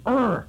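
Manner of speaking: a short, high mock grunt of an oath; mock English oath. Fallen cop's mock oath as he hits the ground. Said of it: "muttering an English oath".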